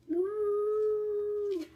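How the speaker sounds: loud, playful moo; cow moo. Acted. Cow in picture book.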